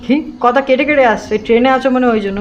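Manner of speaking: normal talking voice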